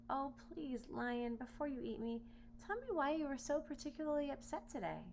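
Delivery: lightly voiced pleading boy.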